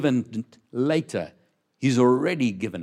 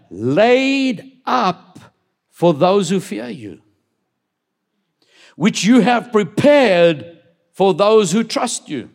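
slow, drawn-out reverent reading; solemn psalm read with long pauses; slow scripture reading; 5 semitones higher, 8 dB louder, slower than usual. Acted. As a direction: savour each word of the psalm.

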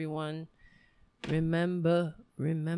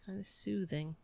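ordinary talk, nothing marked

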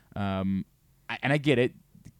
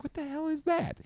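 high-pitched, softer quoted reaction; retold baffled question; 6 dB quieter than usual. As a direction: squeak out the baffled question lightly.

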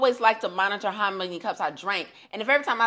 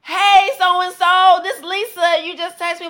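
very slow, much higher and louder hyped "hey"; geeked up greeting; 10 semitones higher, 9 dB louder, slower than usual. Retold or imitated. Phoning the client who texted, geeked up and very loud. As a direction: call her all pumped up and stretch the greeting. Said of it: "I'm geeked up".

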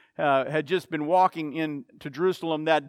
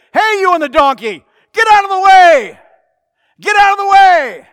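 much higher and louder, furious shout; angry yell; 12 semitones higher, 16 dB louder than usual. Acted. Annoyed visitor yells at the man on the donkey, full furious shout. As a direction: bellow at him to clear the road. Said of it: "he yelled and he said".

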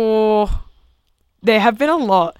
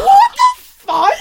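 slow, much higher, louder laughing shriek; shocked laughing cry; 4 dB louder, much slower than usual. Retold or imitated. Retelling the bar story to friends, shocked laughing shriek. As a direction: relive the disbelief, laughing through it. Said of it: "I was like".

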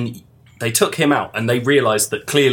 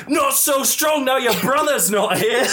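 mock shouted taunt; 10 semitones higher than usual.

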